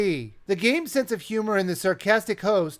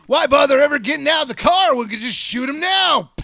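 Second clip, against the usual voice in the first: mock shout; 5 semitones higher, 4 dB louder than usual. Mocking the jeep hunters.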